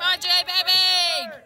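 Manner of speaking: loud drawn-out cheer; cheering shout. Real, not acted. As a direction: call it out across the field. Cheering a batter from stands.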